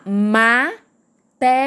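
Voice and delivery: "Matemática" split as ma, te, má, ti, ca; five slow separate beats in "Matemática"; syllable by syllable. Shown as a demonstration. Teacher keeping the last two apart, "Matemática" said in separated syllables. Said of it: "o ti e o cá são separados".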